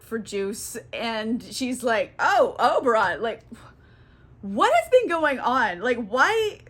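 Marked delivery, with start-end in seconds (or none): 2.2-6.6 hammed-up bewilderment with swooping pitch; higher, baffled questions, played for laughs; mock confusion. Waking queen to Oberon about the donkey man, mock confusion. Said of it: "she's very confused by everything".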